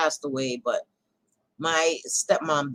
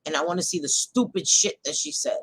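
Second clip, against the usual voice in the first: usual speaking voice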